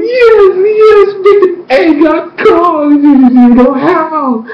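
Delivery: high-pitched, elated fan squealing; so excited. Retold or imitated. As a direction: replay a gushing celebration, stretching the end. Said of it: "That's when I got so excited".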